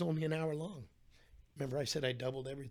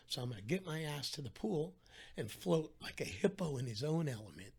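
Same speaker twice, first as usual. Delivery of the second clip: ordinary talk, nothing marked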